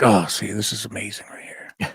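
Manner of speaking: hushed whisper to a friend; whispered aside. Retold or imitated. Telling his buddy about the diner waitress, in a whispered aside. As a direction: lean in and whisper it with delight.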